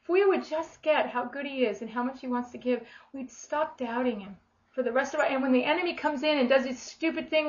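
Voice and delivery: usual speaking voice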